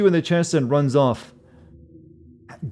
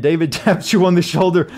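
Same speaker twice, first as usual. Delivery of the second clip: higher, louder, breaking into laughter; amused disbelief; 5 semitones higher, 6 dB louder than usual. Real, not acted. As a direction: react to the absurd twist as you read it. Reading an absurd plot turn aloud.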